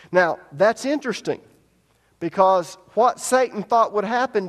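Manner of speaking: loud, high preaching build; fervent preaching. Acted. Preacher explaining Satan's failed plan, loud fervent preaching. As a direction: press the point hard.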